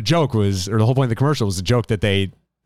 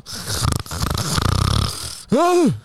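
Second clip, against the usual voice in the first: put-on decrepit gasp; old-timer's gasp; 7 semitones higher than usual. Host playing old and forgetful, a decrepit gasp. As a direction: gasp like an ancient podcaster losing the thread. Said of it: "when we're all old and decrepit".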